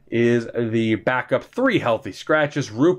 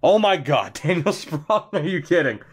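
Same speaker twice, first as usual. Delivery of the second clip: ordinary talk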